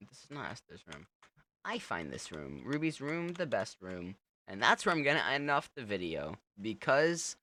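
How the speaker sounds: ordinary delivery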